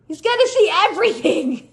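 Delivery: high, loud exasperated protest; exasperated cry. Retold or imitated. Daughter protests to her mom in delivery room, exasperated. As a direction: plead with your mom in disbelief.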